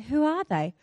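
soft, mild clueless question; imitating a husband who has no idea; unimpressed husband. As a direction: ask it quietly, politely humouring.

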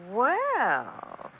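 soft, slow, lingering well; sultry drawn-out well. Acted. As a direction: let the word hang, sizing him up. Woman appraises the caller slowly.

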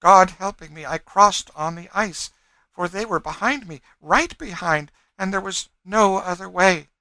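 high, loud, fervent account; fugitive woman. A narrator's reading. Fugitive woman insists on her escape.

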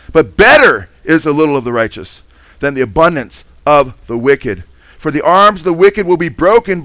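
high, loud, driving sermon voice; preaching at full force. Acted. Pastor urging congregation to trust the Lord, loud preaching. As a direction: press each verse home to the congregation.

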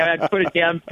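ordinary delivery

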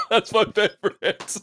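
high, slow words through real laughter; laughing. Real, not acted. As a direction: barely get it out for laughing. Laughing hard at the Mario impression.

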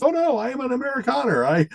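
high, hammed-up Swedish accent; mock Swedish accent. Tourist in Sweden, mock Swedish accent.

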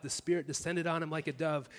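usual speaking voice

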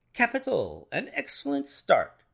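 high, delighted exclamation; sudden cry. A narrator's reading. Detective watching the start through field glass, crying out. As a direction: burst out with sudden excitement. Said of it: "he cried suddenly".

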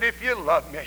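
soft, low IF YOU LOVE ME; hushed quotation. Real, not acted. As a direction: quote it gently and low. First, hushed take of scripture.

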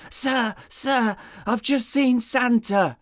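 panicked. Acted. Deputy on the phone to his boss, panicked. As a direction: blurt it out in panic. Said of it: "the panicked voice of Deputy Smallbone".

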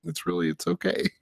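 high, softened comforting voice; tender consoling. Retold or imitated. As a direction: soothe an upset child as if hugging him. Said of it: "I just wanted to hug him".

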